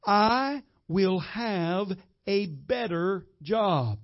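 each word slow and deliberate; drawn-out, measured confession; slow declaration. Shown as a demonstration. Pastor coaching his father, slow and deliberate. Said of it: "I want you to say".

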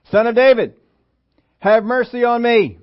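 high, loud desperate call; crying out for mercy. Acted. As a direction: Bartimaeus calling out to Jesus, loud and pleading. Blind beggar crying out to Jesus.

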